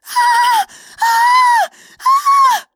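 high piercing screams, very loud; screaming woman. Acted. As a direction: shriek in terror. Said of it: "She kept screaming".

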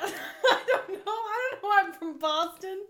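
can't stop laughing while answering; real laughter breaking the words. Real, not acted. Laughing about her accent slip.

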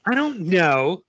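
put-on mildly sulky little girl; high, pouty kid's reply. A narrator's reading.

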